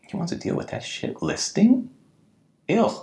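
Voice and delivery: high, hushed incredulous question; mock disgust. Realtors scoffing at a colleague taking an ugly listing, mock disgust.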